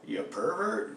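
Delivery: high, incredulous accusing question; a cop's mock-shocked accusation. Cop questions him, shocked.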